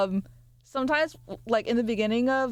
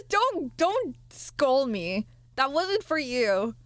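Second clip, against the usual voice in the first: whiny; 3 semitones higher, much slower than usual. Pretend. Mock hurt plea.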